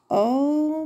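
plain reading voice

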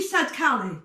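ordinary delivery, nothing marked

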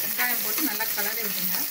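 ordinary talk, nothing marked

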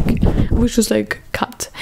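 ordinary talk, nothing marked

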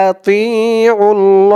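আতিউল ল drawn out very slowly; slow careful আতিউল ল; slow spelled recitation. Shown as a demonstration. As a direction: stretch আতিউল ল syllable by syllable. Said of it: "লাম খারা জবর ল".

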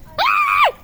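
sudden high frightened shriek; scared shriek. Real, not acted. Scared going downhill on bike.

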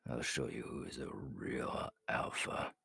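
soft unvoiced teasing promise to the Beta; intimate whisper. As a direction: lean in and whisper to your mate. Leader teasing his Beta, intimate.

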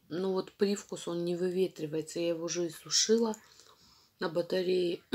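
chalky chewing between words; talking while chewing chalk. Real, not acted. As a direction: mouth partly full.

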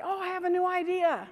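high-pitched burst of eagerness; excited brainwave. Retold or imitated. Speaker mimicking herself calling the COO at ten, hammed-up excitement. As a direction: gush it high, hammed-up enthusiasm. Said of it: "I'm the one that calls at ten o'clock".

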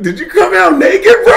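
very high, squeaky mock disbelief; incredulous cop. Cop questions the man without boxers, mock incredulous. Retold cop's disbelief at nakedness.

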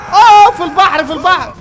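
loud high surprised cry; loud startled gamer outburst; surprised shout. Real, not acted. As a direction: blurt out shock. Car lands in the sea.

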